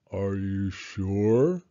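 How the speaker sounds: low, very slow mournful voice; gloomy donkey. Acted. Eeyore doubts Pooh, slow and gloomy. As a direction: drag it out like a weary old donkey.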